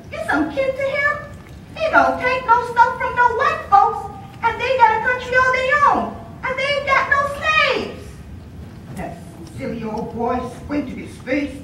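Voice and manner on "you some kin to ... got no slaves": high, eager, quickening speech; a girl excitedly asking about a hero; excited telling. Acted. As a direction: rush it out in wonder. Girl excitedly tells of Haiti.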